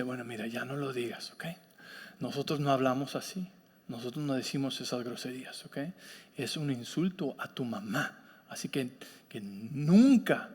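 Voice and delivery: low, hushed fatherly correction; gently but firmly instruct a child, voice kept down; imitating a dad's quiet, unruffled talking-to; calm father. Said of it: "muy tranquilamente".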